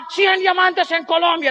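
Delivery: drawn-out mock convention chant; rallying cry. Convention slogan called to the crowd, mock rallying cry. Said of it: "había un grito que se llamaba".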